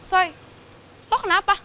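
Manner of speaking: usual speaking voice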